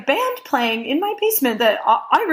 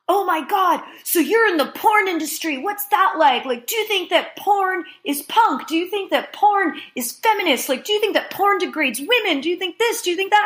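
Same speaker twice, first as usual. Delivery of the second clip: lightly mimicked curious guests, higher; higher, breathless mock-fan questioning; eager partygoers; 8 semitones higher than usual. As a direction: rattle off the questions like a nosy fan.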